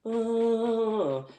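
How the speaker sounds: high put-on groaning oh; mock groan. Mimicking Scouse outside work, high mock groan. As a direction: moan it like a sulking mate. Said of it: "standing outside going".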